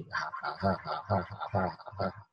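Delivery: soft, low ha-ha pulses; imitated two-syllable tongues. Preacher gives an example of two syllables, low quiet ha-ha. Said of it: "他是这样".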